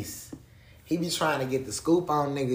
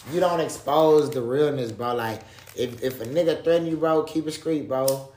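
usual speaking voice

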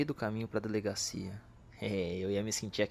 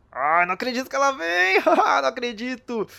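overjoyed; 13 semitones higher, 9 dB louder than usual. Acted. She is coming.

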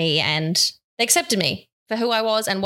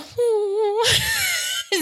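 laugh turning into a cry. Real, not acted. Teased about crying on camera, a real tearful laugh. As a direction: burst out laughing and crying at once.

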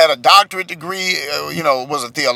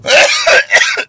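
choking cough; 3 dB louder than usual. Real, not acted. Choking after the hooping bit.

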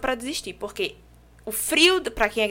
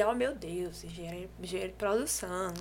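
mock awe; 4 semitones lower, 11 dB quieter than usual. People marvel at an engineer.

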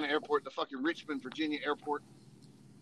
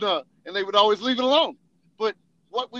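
usual speaking voice

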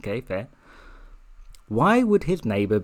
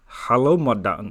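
a light put-on French accent, a bit louder; 4 dB louder than usual. A bellboy greeting madame in a mock French accent. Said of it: "with a softly spoken French, like".

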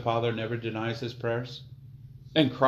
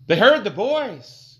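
higher, louder, slow, heard stressed; emphatic preaching; 8 semitones higher, 11 dB louder, slower than usual. Acted. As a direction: lean hard on heard.

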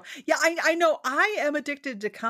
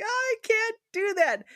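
high, drawn-out cringing protest; teeth-gritting exasperation; 10 semitones higher than usual. Retold or imitated. Writer balking at heroines who need rescue, exasperated. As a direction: balk at it, slow and pitched up. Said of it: "makes my teeth grit".

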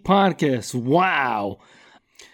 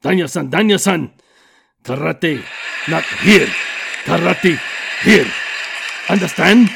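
Mister Miyagi; 3 semitones lower than usual. Retold or imitated. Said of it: "my Mister Miyagi".

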